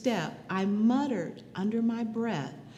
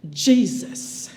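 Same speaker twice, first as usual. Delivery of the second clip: a loud, pleading cry of the name; crying out to Jesus; 3 semitones higher, 6 dB louder than usual. Retold or imitated. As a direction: call out Jesus as if under blows. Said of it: "I'm crying out".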